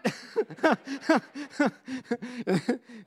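high rising giggle; excited laugh. Retold or imitated. Daughter hearing she gets the truck, giggling gleefully.

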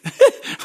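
high, loud burst of laughter; sudden loud laugh. Real, not acted. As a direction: let a big laugh burst out. Preacher laughing at his own story.

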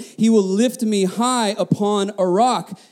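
slow, weighty reading of the verse; slowed, deliberate scripture reading; slow emphasis. Shown as a demonstration. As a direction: lean on each word of the verse. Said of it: "Look at this".

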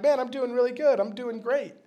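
mock upbeat church greeting; fake cheerful. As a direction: pretend you're doing fine, smiling. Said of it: "You can put on a smile and be like".

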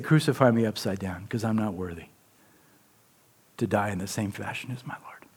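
Peter's last words, hushed to a whisper. Retold or imitated. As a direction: drop to a near whisper for the dying plea.

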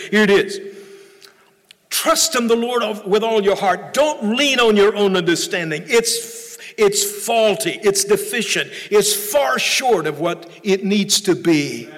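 fervent preacher, rising and falling; preaching. Acted. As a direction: preach it to the congregation. Preaching on the verse.

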